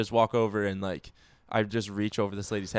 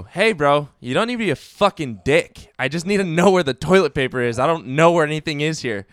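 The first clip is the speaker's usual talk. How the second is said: higher, louder, heated telling-off; retold angry retort; 6 semitones higher, 7 dB louder than usual. Scolding a rude Target worker, heated retold retort. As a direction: put the rude worker in his place.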